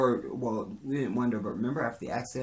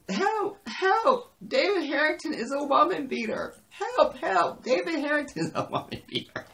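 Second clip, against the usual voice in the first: high, loud mock cries for help; hammed-up shrieking false accusation; mock abused victim; 6 dB louder than usual. Pushed woman plays the abused victim, high mock cries for help. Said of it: "like she's the abused victim".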